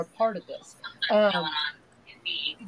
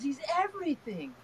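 higher, softer retold child's wonder; imitating grandson; 6 semitones higher, 6 dB quieter than usual. Six-year-old repeating to Nana about God, softly imitated awe. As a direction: lightly voice a little boy's quiet awe. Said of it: "He goes".

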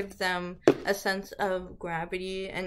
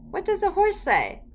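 much higher, louder repeated question; eager prompting; 11 semitones higher, 6 dB louder than usual. Shown as a demonstration. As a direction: coax a toddler to answer, high-pitched. Repeats question, prompting child harder.

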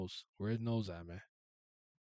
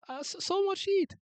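very high squeaky cry of a mortal; scorched mortal; 8 dB louder than usual. Acted. Mortal near the burning god, squeaking high. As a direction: squeal it like the heat is unbearable.

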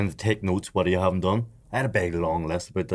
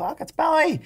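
shocked recognition. Retold or imitated. Sudden recognition of old friend.

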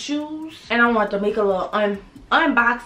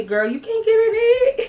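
much higher, sassy viewer jab; mock viewer; 12 semitones higher, 3 dB louder than usual. Imitating viewers doubting her shorts, high and sassy. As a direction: toss it off like a doubting follower. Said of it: "I don't want y'all to say".

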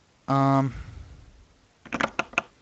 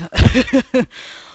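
hysterical laughter; 10 semitones higher, 6 dB louder than usual. Real, not acted. Wins the game, laughing hysterically.

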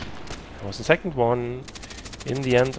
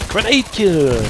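excited; 8 semitones higher, 11 dB louder, slower than usual. Real, not acted. Celebrating a grenade kill.

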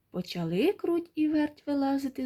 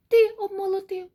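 usual speaking voice

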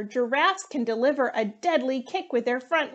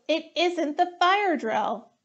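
higher, slow insistent correction; higher and slow, emphatic; 6 semitones higher than usual. Acted. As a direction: spell it out to the panicking class. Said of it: "Hippo yelled".

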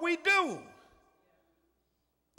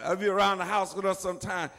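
normal talking voice

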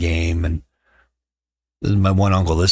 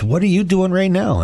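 high-pitched mock wife voice; put-on high voice, retold; imitating wife; 13 semitones higher than usual. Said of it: "the woman looks at me and says".

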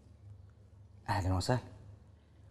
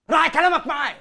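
rising to a loud, higher rebuke; stern warning; 7 semitones higher, 7 dB louder than usual. Acted. Father sternly warns son to watch his tongue. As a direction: warn him sharply to mind his words.

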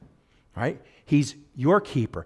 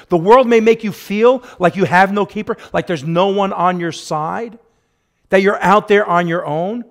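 louder, higher, pressing exhortation; fervent preaching; 3 semitones higher, 5 dB louder than usual. Acted. Pastor urging the flock to read the Psalms, fervent preaching. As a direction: urge them like a pastor on fire.

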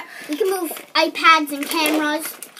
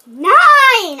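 shouting; 7 semitones higher, 8 dB louder than usual. Real, not acted.

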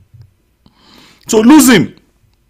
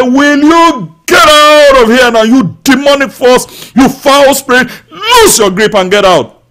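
higher, louder commanding shout; loud rebuke; 7 semitones higher, 5 dB louder than usual. Pretend. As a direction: cast the spirit out at full voice. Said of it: "cry out loud to that situation and say".

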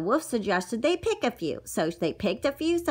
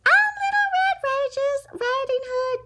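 put-on squeaky little girl introducing herself; high girl voice. Little girl telling the wolf her name, high and squeaky. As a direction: chirp it up high like a small girl.

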